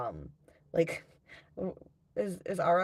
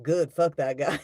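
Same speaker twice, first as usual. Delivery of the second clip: louder, blunt mock dismissal; mock harsh relief; 7 dB louder than usual. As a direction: spit it out like a callous kid. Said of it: "Some people are like".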